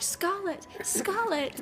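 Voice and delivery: high-pitched mock call of a name; demonstrated theatrical hail; dramatic calling. Shown as a demonstration. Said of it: "really dramatically the bit where it's like".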